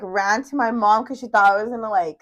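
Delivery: ordinary talk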